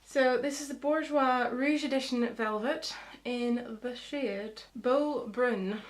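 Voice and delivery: "Beau Brun" said slowly, as careful French; French pronunciation. Shown as a demonstration. Naming her lipstick shade for French speakers, slow mock-French. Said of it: "For all those French speakers out there".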